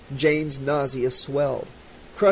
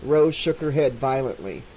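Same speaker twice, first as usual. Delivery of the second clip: ordinary delivery, nothing marked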